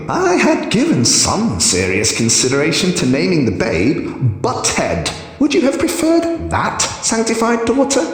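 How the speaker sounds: taunting high voice. A narrator's reading. Hierophant taunts, laughing.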